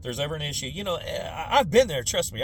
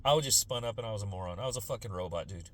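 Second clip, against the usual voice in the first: lower, softer sincere apology; mild apology; 7 semitones lower, 6 dB quieter than usual. Retold or imitated. Apologizing to the officer, low and soft. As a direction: quietly own up to the officer. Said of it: "be like".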